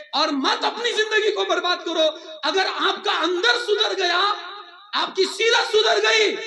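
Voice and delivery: high, rising preacher's fervour; impassioned exhortation. Real, not acted. Preacher urging listeners to reform their character, with rising fervour. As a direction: build to a ringing, high-pitched appeal.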